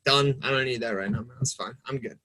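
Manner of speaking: soft, low dismissal fading away; quiet opting-out. Retold or imitated. Walking away from people fighting online, quiet withdrawal.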